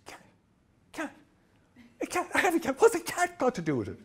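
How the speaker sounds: high, incredulous repetition of cat; imitating an irate man asking why a cat matters; baffled outrage.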